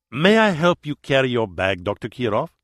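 put-on thick Spanish accent, courteous. A narrator's reading. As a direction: speak it with a heavy Spanish accent. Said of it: "In his thick Spanish accent".

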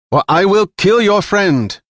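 high, loud cold threat; death threat. Acted. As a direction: promise the killing calmly. Jilan threatens to kill.

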